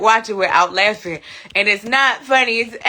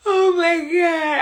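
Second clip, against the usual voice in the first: laughing; 7 semitones higher, slower than usual. Real, not acted. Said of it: "I'm gonna cry".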